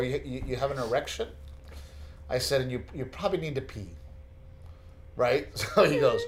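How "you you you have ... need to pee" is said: soft, quick, hesitant aside; delicate questioning. Retold or imitated. Father asks son an awkward question, retold softly and delicately. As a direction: lower your volume for an awkward question.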